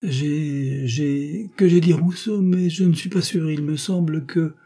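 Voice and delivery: stall, then blurt it out uncertainly; flustered hesitation. A narrator's reading. Husband unsure, stammering excuse. (balbutiement embarrassé, mal assuré)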